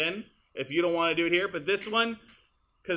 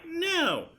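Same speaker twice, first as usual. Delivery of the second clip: imitated high no; 13 semitones higher than usual. Shown as a demonstration. Editor compares two takes, high-pitched imitated no. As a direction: mimic a high, falling "No". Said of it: "the one from this take, she's like".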